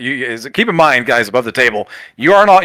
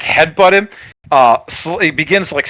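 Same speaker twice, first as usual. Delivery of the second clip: normal talking voice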